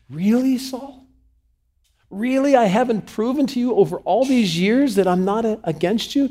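high-pitched, loud incredulity; acted indignant outburst; exasperated protest. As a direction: confront him in hurt disbelief. David confronting Saul, exasperated.

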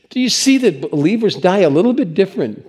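ordinary talk

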